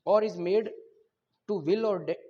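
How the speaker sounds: loud, slow dictation of legal text; slow statute reading. Acted. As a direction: spell out each clause for students to copy. Reads the statute clause.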